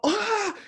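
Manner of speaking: short high-pitched shriek; mock shriek. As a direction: play a startled yelp, very high. Acts out shock at the sight.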